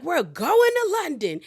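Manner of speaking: high, swooping reveal; mock-thrilled trip announcement; excited announcement. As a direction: imitate a hostess springing a big surprise. [name] announces the London trip.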